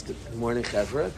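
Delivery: low, hushed greeting to a crowd; gentle, low-pitched public greeting; soft speech opening. Shown as a demonstration. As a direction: start the speech very softly. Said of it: "a healthy speaker starts very soft".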